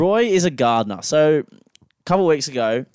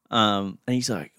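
normal talking voice

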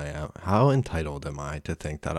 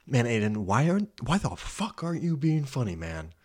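high-pitched exasperated self-reproach, dropping at the end; hammed-up frustrated whine at yourself; mock self-berating; 6 semitones higher than usual. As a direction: berate yourself, half joking. Mock-berating himself for not being funny.

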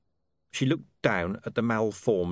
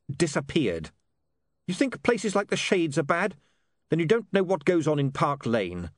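high-pitched, insistent warning; lightly voiced William, heated; 7 semitones higher than usual. A narrator's reading. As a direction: press the warning home about the father.

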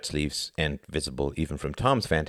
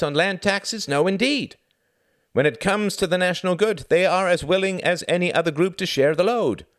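higher, louder, earnest speechifying bald man; desperate politician; 12 semitones higher, 4 dB louder than usual. A narrator's reading. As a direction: plead like a paid, anxious orator.

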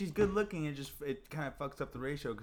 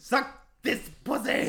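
loud, high, slow imitation; mock crude yell; 11 semitones higher, 9 dB louder than usual. Comic imitating the woman from Chinatown, mock yell. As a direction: bellow it slowly as a hammed-up impression.

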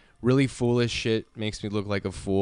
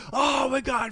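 freaking out. Retold or imitated. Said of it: "freaking out and yelling".

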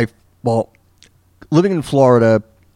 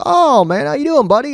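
much higher, friendly lightly voiced host greeting; imitating Bubba. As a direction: greet the caller in a higher put-on voice. Retold Bubba greeting caller on air.

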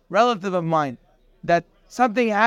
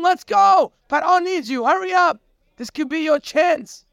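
urgent hurry; 8 semitones higher than usual. Retold or imitated. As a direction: hurry him out the door. Guards rushing Yosef to Paro.

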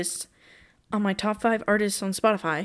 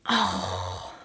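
normal talking voice

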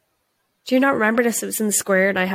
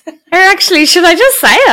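laughing; 12 semitones higher, 11 dB louder than usual. Real, not acted. Laughing, deciding to name it.

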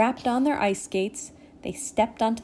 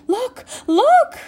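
high, excited calling out, building louder; excited cry; 9 dB louder than usual. A narrator's reading. Tinkerbell alerts the fairies to the lake, crying out. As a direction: cry out to get everyone looking. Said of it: "heard Tinkerbell cry out".

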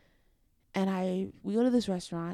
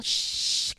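voiceless shush to the crowd; 5 dB louder than usual. Retold or imitated. Shushing noisy guests at her engagement, hushing. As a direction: hush the guests sharply. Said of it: "You were telling your shush".

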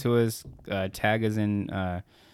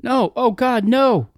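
terrified; 6 dB louder, slower than usual. Acted. Acted 911 call, intruder coming.

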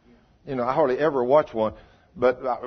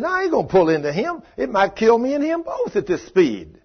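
louder, higher retort in a retold argument; acted protest, pitched up and forceful; emphatic refusal; 9 semitones higher, 5 dB louder than usual.

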